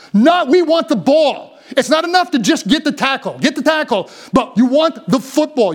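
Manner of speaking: rising preacher's shout; preaching at full force. Real, not acted. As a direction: hammer home that we want the football.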